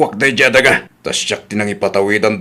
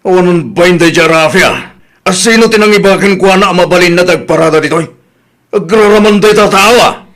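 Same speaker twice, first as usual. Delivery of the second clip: loud, raised furious complaint; angry shouting; 7 semitones higher, 5 dB louder than usual. Acted. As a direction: rant loudly at the trespassing car. Angry father about parked car. (nakapungtot nga agikkis)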